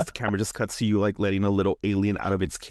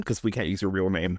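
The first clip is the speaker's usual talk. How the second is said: ordinary talk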